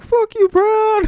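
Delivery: put-on high, loud, drawn-out taunt of a cocky kid; mocking kid taunt. Kids cursing at the teacher.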